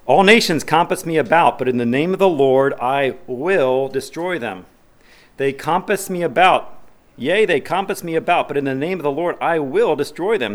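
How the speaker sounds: loud, ringing King James read; forceful scripture reading. Acted. Preacher thundering the psalm to the congregation, loud and ringing. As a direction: proclaim the verse like a battle cry.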